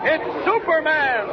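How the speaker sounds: high, slow, ringing announcement; triumphant call-out. Acted. Announcer reveals the hero, slow triumphant call. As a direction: draw out the hero's name. Repeated serial opening call-out.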